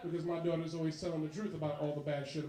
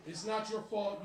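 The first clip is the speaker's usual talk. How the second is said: ordinary talk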